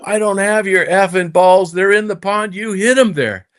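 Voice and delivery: high-pitched protest; mustered courage. Retold or imitated. Scared young player talking back to a golf tough, mustering courage. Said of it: "I muster up the courage".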